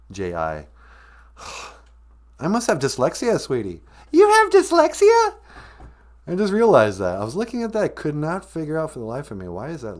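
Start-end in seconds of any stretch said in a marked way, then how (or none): 4.1-5.4 high falsetto, louder question; squeaky surprise. Acted. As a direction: shriek the question in mock shock. Sweetie reacts, falsetto.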